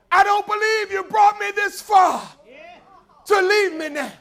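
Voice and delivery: high, loud tearful prayer; a widow crying out in faith; praying and crying. Retold or imitated. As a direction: plead with God through tears. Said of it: "this time praying and crying".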